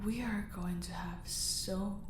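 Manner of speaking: soft, gloating whisper; gleeful whisper. Acted. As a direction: savour the threat quietly. Villain gloating over captive.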